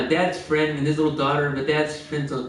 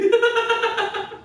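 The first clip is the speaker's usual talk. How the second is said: high laughing fit. Real, not acted. Cracks up telling the story.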